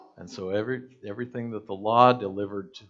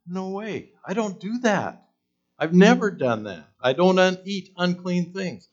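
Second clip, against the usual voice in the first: high, loud protesting no way; Peter's indignant refusal; 9 semitones higher, 10 dB louder than usual. Retold or imitated. Peter protesting to God's voice, high and indignant. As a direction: push back hard, scandalised.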